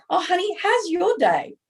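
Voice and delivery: high-pitched, mock-cheery husband; chirpy husband impression. Husband phoning wife to ask about her day, mock chirpy.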